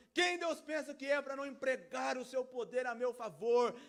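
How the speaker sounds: loud, high, insistent rhetorical questions; indignant complaint. Acted. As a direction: preach it as an outraged demand on God. Acting indignant complaint against God.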